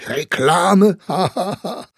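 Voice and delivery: loud, high mocking laugh-line; scornful echo. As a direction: fling the word back with derisive laughter. (lauter, höhnischer Ausruf mit Lachen)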